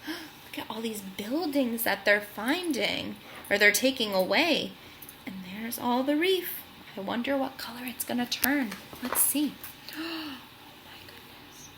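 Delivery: soft, awed aside, fading to a whisper; hushed wonder. Real, not acted. Reader marveling with the children, hushed awe. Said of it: "hushed wonder aside".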